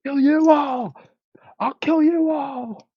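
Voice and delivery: high screamed deathcore shriek; berserk rage. Acted. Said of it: "That does sound like deathcore".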